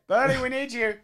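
ordinary talk, nothing marked

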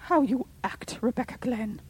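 sharp, high rebuke; scolding. A narrator's reading. Caroline scolding.

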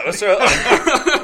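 high, laughing out the words; can't stop laughing; laughing at friends' accents. Real, not acted.

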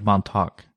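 "Montauk" with a hard final K. Shown as a demonstration. Host defending his pronunciation to co-host, "Montauk" ending in K. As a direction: end "Montauk" on a clear K, MON-tock. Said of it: "I always thought it was a K".

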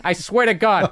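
normal talking voice

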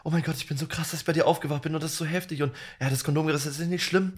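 put-on soft, breathless gushing girl voice; hushed and starry-eyed, quietly gushing; imitating the girl. S0 imitating the girl waking beside him, soft and gushing. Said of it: "sie war so".

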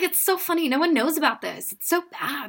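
put-on high, excited teen voice; gushing teen. A teenage fan gushes about her cult show, high. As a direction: gush like a fifteen-year-old fan. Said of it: "I was like".

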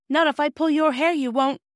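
high, loud, fierce retort; angry threat. A narrator's reading. Phyllis springs at her brother, furious. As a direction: lash out as if about to pounce.

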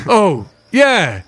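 playing along. Guard going along with his mate's winking ruse, put-on realisation. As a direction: exaggerate the sudden "oh, yeah" for show.

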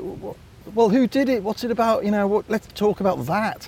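high, eager and keen; eager editor. Retold or imitated. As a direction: perk up, let's do it.

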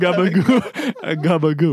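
New Jersey Italian gabagool; mock Sopranos gabagool; Jersey Italian accent on gabagoo.

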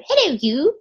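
high-pitched mock-regal telephone hello; high, playful imitation of royalty on the phone; the Queen's phone greeting. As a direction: greet like the Queen ringing her sister. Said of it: "that telephone greeting from The Crown that the Queen uses".

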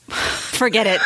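breaking into giggles; stifled giggles. Real, not acted. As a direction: gives up, laughing. Giving up mid-giggle.

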